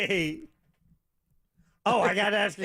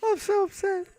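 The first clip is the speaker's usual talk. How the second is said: breathy high starlet voice, much higher; high, breathy and wounded; Marilyn Monroe; 12 semitones higher than usual. Pretend. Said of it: "Miss Marilyn Monroe".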